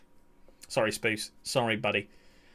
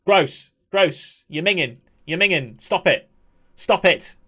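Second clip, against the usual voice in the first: louder and higher disgusted jeers; 4 semitones higher, 5 dB louder, slower than usual. Real, not acted. Streamer heckling a cringe texter, disgusted. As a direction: heckle the sender.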